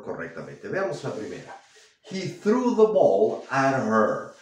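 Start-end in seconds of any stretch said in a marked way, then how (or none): 2.1-4.3 slow, careful read of the example; slow model sentence. Shown as a demonstration. Teacher reading the example slowly to students.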